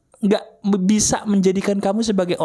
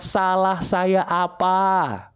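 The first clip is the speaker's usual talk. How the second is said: slowly pleading the line as a model to copy; very slow drawn-out plea; 3 semitones higher, much slower than usual. Shown as a demonstration. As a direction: stretch each word of "Salah saya apa" long and slow. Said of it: "Teriak di bantal".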